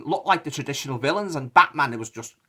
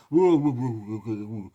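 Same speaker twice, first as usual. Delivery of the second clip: mumbling actor; 3 semitones lower than usual. Retold or imitated. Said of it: "He was mumbling his lines".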